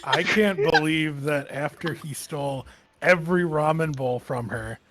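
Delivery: ordinary talk, nothing marked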